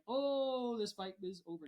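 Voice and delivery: high, loud, stretched-out "oh"; excited spectator reaction. Retold or imitated. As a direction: imitate a fan reacting to a knockdown. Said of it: "And you're like".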